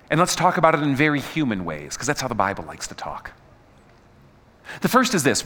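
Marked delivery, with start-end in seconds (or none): 2.0-3.3 whispered aside. Real, not acted.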